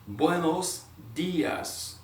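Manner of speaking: "Buenos dias" stretched out very slowly; very slow musical. Shown as a demonstration. Teacher models "Buenos dias" very slowly and musically. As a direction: draw out every syllable of "Buenos dias". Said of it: "it's almost musical".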